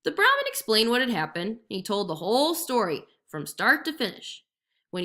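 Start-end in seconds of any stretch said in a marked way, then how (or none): none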